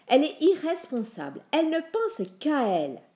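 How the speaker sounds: high, loud, indignant outcry; cri aigu. A narrator's reading. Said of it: "criait la voix aiguë". (voix aiguë qui s'indigne)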